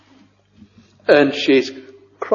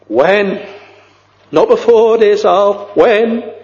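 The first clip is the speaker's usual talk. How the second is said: pitched high, pressing each When; preacher urging his flock in fervent rising voice; fervent asking; 7 semitones higher than usual. Acted. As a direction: plead with the congregation, rising.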